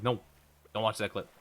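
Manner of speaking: ordinary talk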